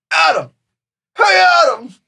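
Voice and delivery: put-on high, loud cry breaking as he calls the kid; choked-up calling out. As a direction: shout for the boy with a voice cracking with tears. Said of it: "you can kinda hear him choking up as he's calling".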